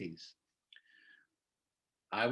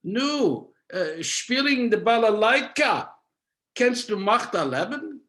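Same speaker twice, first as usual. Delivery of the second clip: higher, louder, a doubting elder's Yiddish question; family elder's challenge; 7 semitones higher, 5 dB louder than usual. Retold or imitated. Family doubts Levik can live by balalaika, higher and louder. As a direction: sound dismayed at the boy's balalaika dreams. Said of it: "here it comes".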